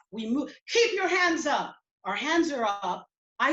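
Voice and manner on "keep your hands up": high, raised commanding voice; shouted police order. Retold or imitated. Cop holding students at gunpoint, shouting an order. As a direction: call it out as an urgent order.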